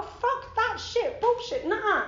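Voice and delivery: mock outraged nah; scornful refusal. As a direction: throw it away with scorn. Refusing to quit alcohol.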